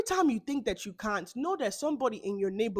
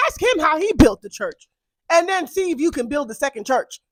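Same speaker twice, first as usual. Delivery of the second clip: loud, high rant; angry rant about the pastor; yelling; 6 semitones higher, 10 dB louder than usual. Real, not acted. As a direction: shout it like a scolding. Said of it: "that's all the yelling I have for me today".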